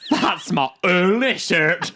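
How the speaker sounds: high, loud Irish accent impression; mock Irish accent, high and drawn out. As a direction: do Kendra in a broad Irish accent, last words slow. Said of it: "in my Irish accent".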